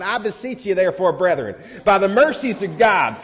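high and loud, building to a laughing shout; mock fiery Southern preaching, half laughing; fiery young preacher. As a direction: preach it like a fired-up Southern college kid. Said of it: "I was into it".

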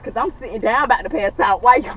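normal talking voice

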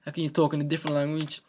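usual speaking voice

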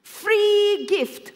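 high, loud, stretched-out exclamation; excited mock discovery. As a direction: imitate mum lighting up at a freebie. Mum spots a free gift counter.

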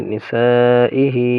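very slow নিসাইহি with a long-held "saa"; four-alif madd. Shown as a demonstration. Teacher demonstrates four-alif madd, নিসাইহি greatly drawn out. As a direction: stretch the "saa" in নিসাইহি far out before "ihi". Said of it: "চাইর আলিফ মদ এখানে".

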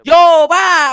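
veel hoger en luider, joviaal roepen; shouting. Acted. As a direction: schreeuw een groet naar de agent. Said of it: "jangan teriak-teriak".

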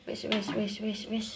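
quiet hushing "wis, wis". Real, not acted. Soothing child, quietly. (menenangkan anak dengan pelan)

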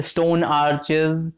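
stone arches, said slowly with a clear ch; slow arches demo. Shown as a demonstration. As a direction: drawl out stone arches, ch sounded. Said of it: "the only time I actually use the H is when I say arches".

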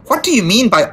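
high-pitched, indignant challenge; affronted father's sharp question; demanding. A narrator's reading. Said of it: "Mister Darling demanded".

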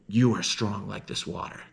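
ordinary talk

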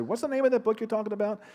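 high, lightly voiced young woman asking; quick voiced question from a young woman; curious young woman. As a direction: play the curious guest at the table. Woman at Thanksgiving table asks.

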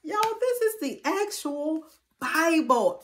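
high, excited double take; thrilled surprise; amazed discovery. Retold or imitated. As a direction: act out suddenly realising it's a real Bible. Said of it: "I was like".

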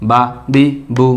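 brief, unstretched vowels on বা, বি, বু; quick short vowels. Shown as a demonstration. Quran teacher repeats the correct way for learners, বা, বি, বু with short vowels. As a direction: keep each syllable of বা, বি, বু short and separate. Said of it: "হরকতের উচ্চারণ অবশ্যই তাড়াতাড়ি করতে হবে".

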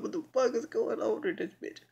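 high, baffled outburst; confused disbelief. Retold or imitated. Confused friend reacting to the shooting offer, high baffled disbelief. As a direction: ask it high and thrown. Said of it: "Yasmin was confused".

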